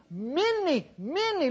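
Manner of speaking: "many, many" pitched high and drawn out; repeated "many", each one lifted and weighty; stress on "many". Shown as a demonstration. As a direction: stretch each "many" for emphasis. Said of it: "look at that, the word many".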